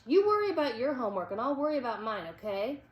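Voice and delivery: fast, sharp telling-off; angry snap. A narrator's reading. Sean telling Bradley to mind his own homework, fast angry snap. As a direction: rattle it off fast to shut him up. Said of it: "Sean snapped".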